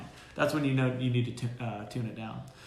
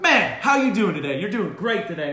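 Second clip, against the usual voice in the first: forced cheerfulness; 5 semitones higher, 8 dB louder than usual. Retold or imitated. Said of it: "and I'm like".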